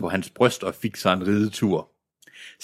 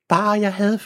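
put-on much higher, small girl voice; child's voice. A narrator's reading. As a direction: lift it up into a little girl's wish. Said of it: "sagde hun".

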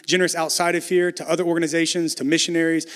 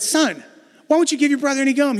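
higher, louder and fast, exasperated dad; 9 semitones higher, 4 dB louder than usual. Retold or imitated. Dad asking son why he won't share, exasperated. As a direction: demand it like a fed-up father.

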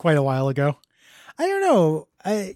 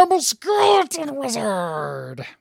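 high, slow, drawn-out wizard wail; skeleton wizard voice; 8 semitones higher, much slower than usual. Shown as a demonstration. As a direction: stretch it out like a spooky cartoon wizard. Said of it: "That's my other voice".

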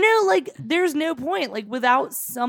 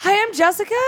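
high, bright server greeting; chirpy server voice; 9 semitones higher than usual. Pretend. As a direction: play a cheerful waitress. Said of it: "Oh, sorry. playing server".

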